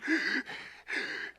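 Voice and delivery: quick high gasp for air; mock breathless gasp. Runner showing the congregation his breathlessness, mock gasp. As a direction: gasp like you just ran out of air. Imitating breathlessness after short run.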